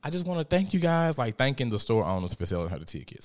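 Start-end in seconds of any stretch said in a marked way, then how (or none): 0.0-1.2 high, breathless mock gushing; mock hysterical gush. Winner thanking store owners, mock hysterical and high. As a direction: imitate a giddy lottery winner overdoing it. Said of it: "she was very hysterical".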